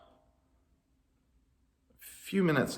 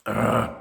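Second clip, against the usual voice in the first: loud groan of frustration; exasperated groan; 5 dB louder than usual. A narrator's reading. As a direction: groan at their ingratitude. Moses exasperated by complainers.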